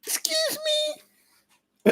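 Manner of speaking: very high, tiny falsetto apology; imitating the Walmart clerk. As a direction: squeak it out daintily like she did. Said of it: "'Cause she goes".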